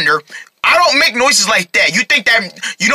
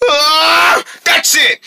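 high exasperated yell; fed-up outburst; 7 semitones higher, much slower than usual. Acted. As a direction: explode because the noise is unbearable. Snaps over the annoying noise.

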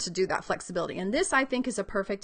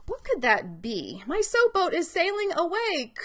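put-on higher, louder voice of a child working out a word; student puzzling aloud; 8 semitones higher, 4 dB louder than usual. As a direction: sound like a young reader thinking it through. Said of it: "when they have to think through".

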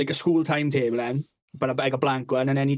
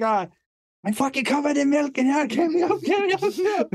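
high, squealing plea to be let out; mock panic, voice climbing with each get me out; panicked kid; 10 semitones higher than usual. Trapped boy in the cupboard begging his mates, high panicked squeal. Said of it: "And he's like".

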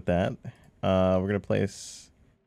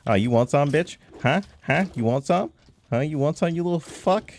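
taunting; 7 semitones higher, 3 dB louder than usual. Real, not acted. Taunting a mob after scare.

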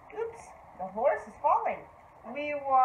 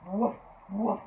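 dog bark; 4 semitones lower, 3 dB quieter than usual. Shown as a demonstration. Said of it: "He says".

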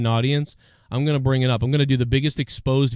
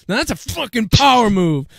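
loud, high boast punching POWER; raised-voice, hammed-up bragging; mock triumphant yell; 7 semitones higher, 5 dB louder than usual. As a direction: crow it like a champion. Crowing over the imagined bump.

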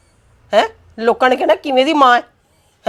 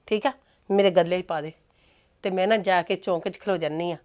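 softer, mock-sweet sarcasm; sarcastic; 6 semitones lower, 11 dB quieter than usual. Mother mocks son's plan to find the girl, soft and sarcastic. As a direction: lay the ridicule on gently and quietly.

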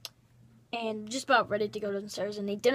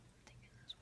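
whisper; 27 dB quieter, much faster than usual. Real, not acted. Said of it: "I'm thinking I'm taking this one".

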